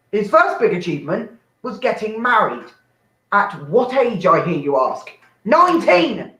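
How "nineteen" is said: mock shocked reveal. Dramatic reveal.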